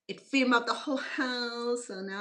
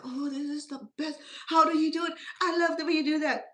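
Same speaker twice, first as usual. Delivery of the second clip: high, excited impression of a thrilled sweetheart; imitated gushing praise; 7 semitones higher, 7 dB louder than usual. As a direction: gush loudly and high over the food. Acting out sweetheart's delighted praise.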